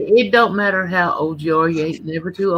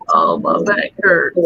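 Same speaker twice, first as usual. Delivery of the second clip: faked back pain; 11 semitones lower, 3 dB louder than usual. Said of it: "and she said".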